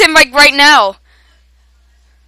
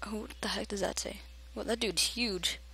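whispered, much softer puzzled question; 20 dB quieter than usual. Real, not acted. Gamer squinting at a name on screen, whispering. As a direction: whisper it to yourself. Quiet puzzled aside to viewers.

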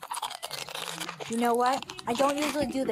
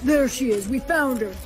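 normal talking voice

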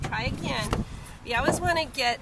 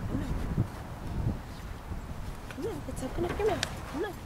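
coaxing; 8 semitones higher, 6 dB quieter, faster than usual. Real, not acted. Coaxing the dog to open mouth.

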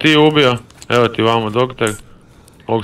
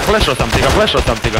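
excited callout; 4 semitones higher than usual. Real, not acted.

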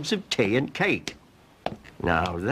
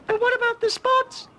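cried out. Acted. Ross crying out at Cromarty's spots, squeaky. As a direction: yelp in shock like a cartoon boy. Ross's boy voice.

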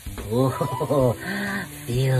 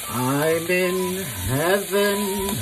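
slow; 2 semitones higher, much slower than usual. Acted. Slow drawn out.

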